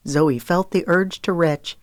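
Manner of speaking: ordinary delivery, nothing marked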